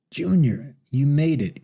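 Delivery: lightly voiced old man calling out with effort; croaked loudly. A narrator's reading. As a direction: push the greeting out as loud as a frail voice can. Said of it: "croaked Billy as loudly as he could".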